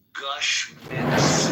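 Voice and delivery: "Mints" with a hissy, lisping S; slight echo of a lisp on "Mints". Real, not acted. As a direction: say "Gush Mints" with the S slipping into a lisp. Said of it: "Does it sound like I'm lisping".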